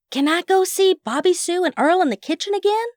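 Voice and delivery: put-on high, bouncing excited boy voice; high-pitched eager plea. A narrator's reading. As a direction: ask as if bursting to go. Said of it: "practically vibrating in his chair".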